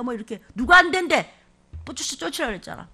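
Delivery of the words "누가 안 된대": indignant retort. Real, not acted. Preacher retorting loudly.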